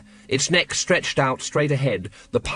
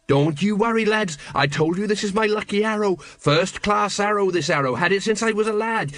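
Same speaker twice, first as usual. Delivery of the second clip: high, nervous false confidence; nervous bravado; 7 semitones higher than usual. Acted. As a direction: bluff through the fear. Colon bluffing through fear.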